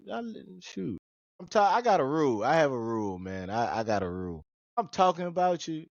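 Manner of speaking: ordinary delivery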